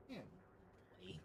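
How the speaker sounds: breathy whisper to the dog; soft whisper, no voice; whispered praise. Real, not acted.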